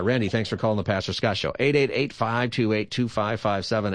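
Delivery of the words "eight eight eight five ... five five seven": phone number rattled off quickly; brisk radio phone-number read; too fast. Real, not acted. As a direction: run the digits together in quick groups. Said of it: "You said it too fast".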